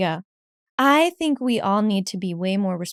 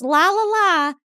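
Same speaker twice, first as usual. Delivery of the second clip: high lightly sung la la la; carefree oblivious hum; oblivious singsong; 9 semitones higher, 5 dB louder than usual. Retold or imitated. Mimicking a clueless person, lightly sung. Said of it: "not knowing what they're doing".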